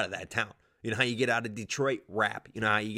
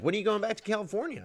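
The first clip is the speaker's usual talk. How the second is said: high nagging question; 11 semitones higher, faster than usual. Retold or imitated. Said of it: "people were like".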